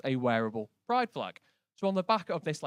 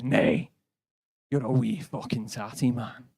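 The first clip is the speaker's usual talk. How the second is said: put-on thick Glaswegian accent, insistent; 3 semitones lower, slower than usual. The Glasgow bloke insisting on the insult, thick Glaswegian accent.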